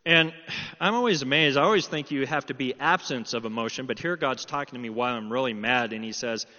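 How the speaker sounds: usual speaking voice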